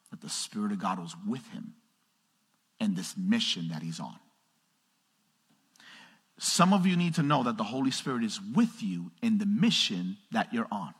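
ordinary delivery

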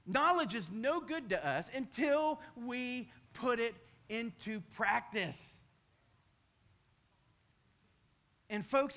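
preaching. Acted. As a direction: drive the moral home from the pulpit. Preaching the moral at full force.

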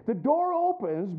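ordinary delivery

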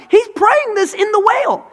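very high, loud exclamation; impassioned preaching. Real, not acted. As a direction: preach it at the top of your range.

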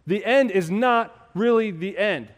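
loud, high preaching voice; building sermon climax; fiery preaching. Acted. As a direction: preach it with full force. Preaching at full force.

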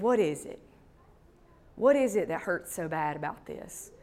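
soft, quiet, caring question; tender grandma question. Retold or imitated. Grandma softly asking her hurting granddaughter. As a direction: ask gently, a grandma drawing her out. Grandma asks what hurts.

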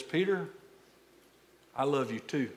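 soft and low, full of tenderness; a gentle, loving reassurance; tender Jesus. Retold or imitated. Jesus answering Peter, soft and tender. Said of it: "with all the tenderness".

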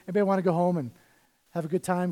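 ordinary delivery

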